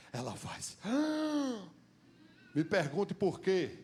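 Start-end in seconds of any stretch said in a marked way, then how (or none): 0.8-1.6 sharp gasp of surprise; the Samaritan woman gasps, caught off guard; startled gasp. Acted. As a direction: catch your breath in shock.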